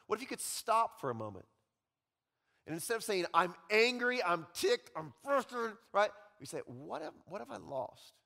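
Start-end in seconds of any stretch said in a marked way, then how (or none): 3.3-5.8 loud, higher list of grievances; mock angry venting. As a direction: vent like a ticked-off worker. Voicing frustrated worker.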